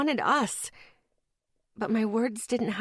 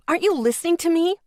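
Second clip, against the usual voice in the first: high, loud and fast shout; 8 semitones higher, 5 dB louder, faster than usual. A narrator's reading. Narrator shouts at boyfriend walking away, loud and high. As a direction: hurl the question at him. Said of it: "I shouted".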